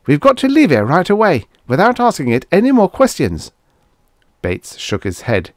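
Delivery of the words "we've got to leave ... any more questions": high, louder, insistent appeal; desperate urging. A narrator's reading. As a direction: urge immediate escape with raised pitch. Acted argument, desperate urging.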